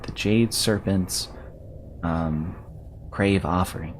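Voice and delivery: light fairy-sprite voice. Acted. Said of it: "That's my sprite voice".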